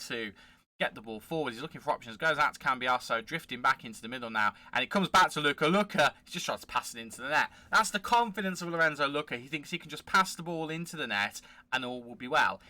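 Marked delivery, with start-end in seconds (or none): none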